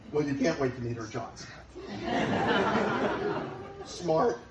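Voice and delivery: ordinary talk, nothing marked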